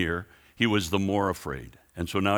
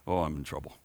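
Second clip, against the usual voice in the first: ordinary talk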